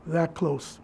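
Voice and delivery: "that close" dropped low and soft; hushed echo. Real, not acted. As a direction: let it sink to a quiet, low repeat. Sorrowful echo of the phrase.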